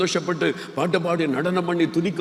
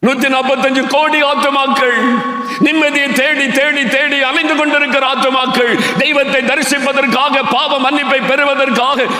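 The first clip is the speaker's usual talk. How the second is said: high, fervent preaching; rally the church with rising fervour; preaching at full force; 10 semitones higher, 5 dB louder than usual. Real, not acted. Rising sermon passage. (உரத்த உணர்ச்சிமிக்க பிரசங்கம்)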